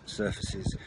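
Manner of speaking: ordinary talk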